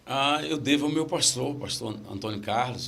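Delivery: ordinary talk, nothing marked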